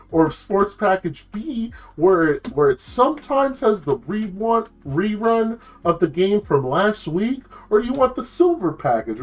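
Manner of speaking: high, singsong mock-helpful operator; snarky turtle. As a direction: toy with the caller in a high cartoon turtle voice. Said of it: "He has this, like, really snarky voice".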